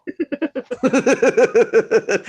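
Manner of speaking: long, high, exaggerated gasp; shocked gasp. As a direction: gasp as if scandalised. Mock shock at reported slur.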